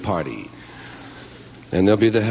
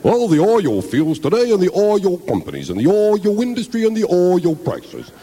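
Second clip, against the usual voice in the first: higher, louder anchorman with "oil" as "oy-yull"; Walter Cronkite impression; 10 semitones higher, 4 dB louder than usual. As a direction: read the news like a grave TV anchor, slow. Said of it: "the way he says the word oil".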